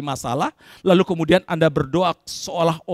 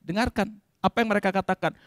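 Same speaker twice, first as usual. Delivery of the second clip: ordinary talk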